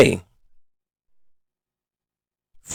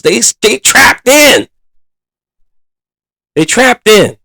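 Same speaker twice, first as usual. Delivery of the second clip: fired-up rant; 8 semitones higher, 5 dB louder than usual. Real, not acted. Frustrated fan venting about offense.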